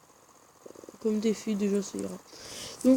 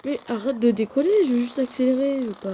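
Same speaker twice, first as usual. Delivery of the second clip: louder, higher frustration at the game; frustrated; 4 semitones higher, 8 dB louder than usual. Real, not acted. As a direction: snap at the bike in annoyance. (agacé contre le jeu)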